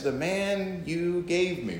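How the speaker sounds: slow, drawn-out aggrieved wife; wife complaining. Acted. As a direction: toss it back at the husband, slowly. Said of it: "she looks at you and says".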